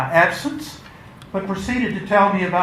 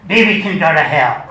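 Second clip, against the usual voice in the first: scornful dismissal; 8 dB louder than usual. Acted. Dismissing her companion.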